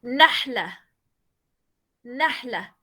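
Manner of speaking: nahla with a clear breathy final h, said slowly twice; Arabic teacher repeats nahla, stressing the ending; Arabic word demo. Shown as a demonstration. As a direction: spell out nahla so the ending ha is heard. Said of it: "La palabra".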